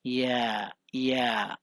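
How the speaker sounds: the lone vowel या said twice, open and clear; teacher isolates vowel four; vowel demonstration. Shown as a demonstration. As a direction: sound out या like the a of cat. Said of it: "English vowel sound number four".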